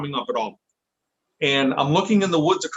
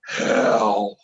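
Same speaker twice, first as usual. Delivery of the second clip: deep gruff voice; 6 semitones lower, 3 dB louder than usual. Retold or imitated. Retelling the spirit box answer in a low, gruff voice. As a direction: lower a touch on "Hell," for a big, gruff spirit voice. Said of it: "this big, deep, gruff voice".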